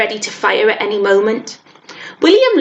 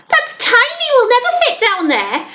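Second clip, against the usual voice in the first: put-on very high-pitched boy's protest; alarmed exclamation. A narrator's reading. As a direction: exclaim in high, squeaky disbelief. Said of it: "William exclaimed".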